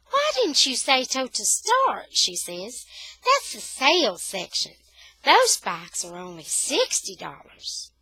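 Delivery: high, loud friendly clerk; cheerful store lady. A narrator's reading. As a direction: explain it with a bright lilt. Lady explains sale section.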